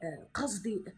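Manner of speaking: stall, searching for an excuse; hesitant mumble. Acted. Hesitant evasive reply. (تردد بصوت خافت بطيء)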